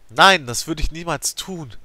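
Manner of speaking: loud, high, slow denial; trembling denial. A narrator's reading. Narrator desperately denying she killed her friend. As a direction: deny it in desperate horror. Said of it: "Das Zittern meiner Stimme".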